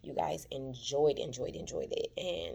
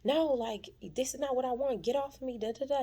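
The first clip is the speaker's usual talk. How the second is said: mock yelling; 9 semitones higher than usual. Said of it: "screaming and yelling".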